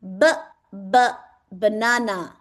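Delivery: "B, b" with an exaggerated lip b, then "banana"; clear b sound demo. Shown as a demonstration. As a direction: show the mouth shape on "B, b" for the child. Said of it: "look at my mouth and say". ("B, b" पर होंठ साफ़ दिखाते हुए, फिर "banana")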